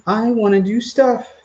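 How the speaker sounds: high and slow, pleading puppy; whiny puppy voice. Pretend. Owner voicing his restless puppy, slow high whine. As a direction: stretch it out like a bored pup. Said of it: "She's like".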